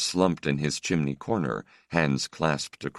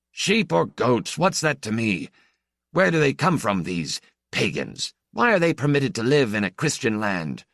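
haughty stranger priest; 8 semitones higher than usual. Acted. Stranger priest to the old priest, scornful. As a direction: scold like an arrogant cleric.

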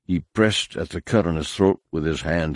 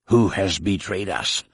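hissed threat, lightly voiced; hissed in his ear. A narrator's reading. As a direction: threaten him in a hiss from behind. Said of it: "hissed a voice in his ear".

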